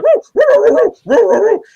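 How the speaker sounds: high, loud scared dog whine; dog whimpering. Pretend. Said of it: "The dog would be like".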